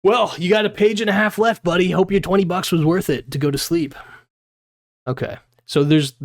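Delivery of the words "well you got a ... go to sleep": ordinary talk, nothing marked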